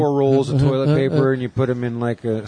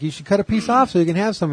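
quick, higher, sing-song parent voice; teasing parents; 9 semitones higher, faster than usual. Retold or imitated. Parents luring the birthday kid to cut the cake, sweet and fast. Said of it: "they're like".

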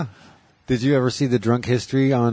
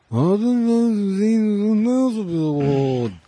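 lightly hammed-up rocker mumble, pitched up; 9 semitones higher, much slower than usual. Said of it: "terrible [name] trying to be Aussie".

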